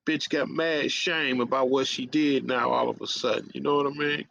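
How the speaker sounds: normal talking voice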